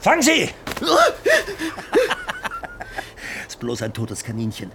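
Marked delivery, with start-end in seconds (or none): 0.5-3.4 high, wild cackling laugh; manic laugh. Acted. Samuel laughing at the shocked Foxfinder, manic cackle. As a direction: cackle like a madman.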